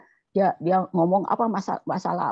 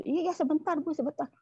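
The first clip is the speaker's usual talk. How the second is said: lightly voiced helper, higher and softer; retold hasty reassurance; 6 semitones higher, 5 dB quieter than usual. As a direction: imitate a quick, soft reply on the phone. Phone reply of helper, retold. (menirukan jawaban singkat di telepon)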